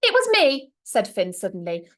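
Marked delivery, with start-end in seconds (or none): 0.0-0.7 put-on high, loud little boy; blurted confession. Finn confessing to Grandma, high and blurted. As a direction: blurt it out before you lose your nerve. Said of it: "the words tripping over themselves".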